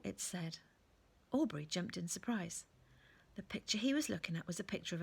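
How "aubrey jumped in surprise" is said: ordinary delivery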